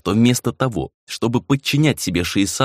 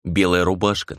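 plain reading voice

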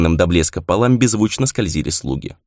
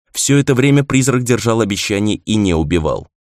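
usual speaking voice

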